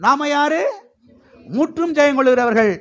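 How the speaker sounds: high rising questions, ringing answers; preaching call-and-response. Real, not acted. Preacher drilling church on who they are, rousing call-and-response. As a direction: quiz the crowd, then proclaim the answer high.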